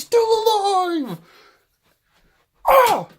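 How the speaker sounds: thrilled yelling, high and loud; excited. Real, not acted. Gamer cheering her own throw, excited yell. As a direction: shout in delight at the throw.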